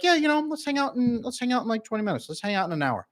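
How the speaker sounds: high, airy put-off voice, easing away; breezy avoidant partner. Retold or imitated. An avoidant partner putting off time together, airy and high. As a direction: brush it off lightly and stall. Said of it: "Avoidant people might be like".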